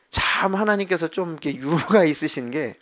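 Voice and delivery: normal talking voice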